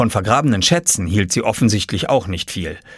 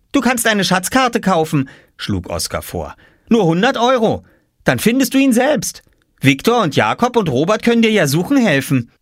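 put-on high, cocky child salesman; lightly voiced smug little boy; cheeky boy; 11 semitones higher than usual. A narrator's reading. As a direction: sell her the map sweetly. Oskar offering a deal.

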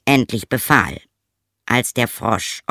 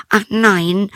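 high, loud princess exclamation; high storybook princess; 11 semitones higher, 6 dB louder than usual. Acted. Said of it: "antwortete sie".

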